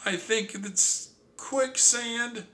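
very high, slow frightened squeak; alarmed. Acted. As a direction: dread the words. Sister realizing danger.

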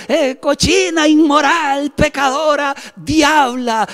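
high-pitched string of hammed-up insults; mock scolding. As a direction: denounce in a drawn-out, high mock outrage. Mock scolding reply never sent. (regaño agudo, con indignación fingida)

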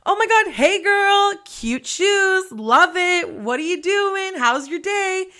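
high, slow, sugary fake-friendly chatter; bubbly lunch lady greeting the kids; gushing lunch lady. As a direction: gush over her like an overfriendly lunch lady. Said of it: "I'm that fucking lunch lady that's like".

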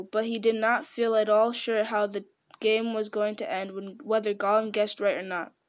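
ordinary delivery, nothing marked

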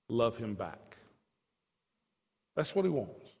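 hushed sincere plea to the congregation; soft intimate appeal. Real, not acted. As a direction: draw them in gently.

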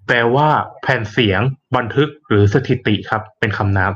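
ordinary talk

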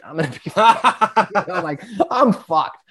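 words breaking into real laughter; laughing. Real, not acted. Teller recalling his first night in jail, laughing. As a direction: laugh through it, pitch riding up.

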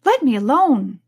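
indignant Alice. A narrator's reading. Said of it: "said Alice indignantly".

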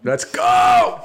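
very high, loud victory yell; excited shout. Real, not acted. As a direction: yell it out in triumph. Wins the count, cheering.

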